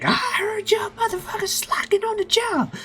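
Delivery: very high, loud falsetto woman; bossy pirate lady. Acted. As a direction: screech orders at the crew like a tyrant. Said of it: "she's like".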